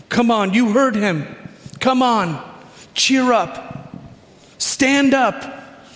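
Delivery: high, eager encouragement; excited urging. Acted. Crowd telling you he's calling for you, excited urging. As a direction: urge them up with rising excitement. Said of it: "there's now a feeling of excitement".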